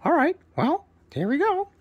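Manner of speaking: high, loud squawky parrot; proud parrot introducing himself, high and loud. Acted. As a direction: chirp it out as a cheerful parrot bird. Parrot sets off.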